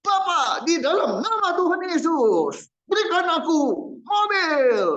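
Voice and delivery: a preacher parodying a sobbing, shouted prayer for a car; mock crying demand prayer. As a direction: ham up a tearful demand for a car.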